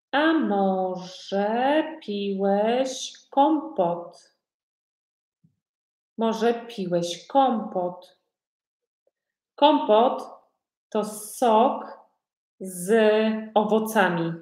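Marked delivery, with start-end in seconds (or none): 0.1-4.3 words stretched right out for learners; very slow modelling. Shown as a demonstration. Teacher asks a learner about kompot, very slowly. 9.5-14.3 word-by-word definition. Shown as a demonstration. Teacher explains kompot to learners, piece by piece.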